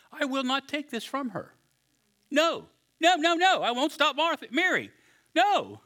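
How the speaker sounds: high, emphatic no's; firm refusal. Retold or imitated. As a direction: shut it down firmly but kindly. Jesus voiced as firm.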